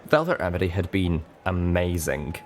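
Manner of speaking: usual speaking voice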